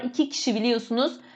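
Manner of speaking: normal talking voice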